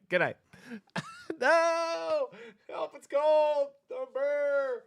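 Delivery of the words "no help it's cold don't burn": high hammed-up cries for help; mock panic.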